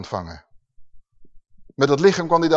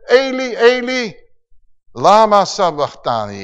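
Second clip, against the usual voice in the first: loud cry; 3 semitones higher, 4 dB louder than usual. A narrator's reading. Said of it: "met luide stem".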